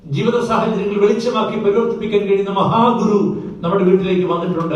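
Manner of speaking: high, loud pulpit proclamation; fervent preacher's cry; full-force preaching. Acted. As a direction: thunder the promise to the congregation.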